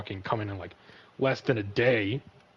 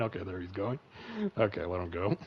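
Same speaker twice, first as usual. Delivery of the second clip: usual speaking voice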